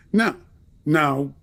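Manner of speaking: ordinary talk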